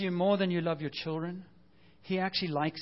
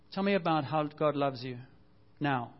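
normal talking voice

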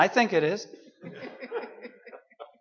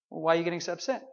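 gently teasing; calm God voice; 7 dB quieter, much faster than usual. Retold or imitated.

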